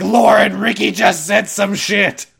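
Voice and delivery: high, loud re-enacted outburst; hammed-up alarmed yell to a friend; retold furious messaging. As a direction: cry out the urgent message, slowing at the end. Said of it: "started furiously writing messages".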